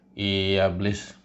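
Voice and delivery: low, sulky complaining voice; low, sulky complaint to the devil; low grumbling Kurnia. Acted.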